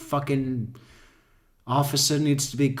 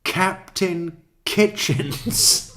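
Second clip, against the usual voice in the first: mock drawn-out name; 8 semitones higher, 5 dB louder, much slower than usual. Real, not acted. Mocking the officer's funny name.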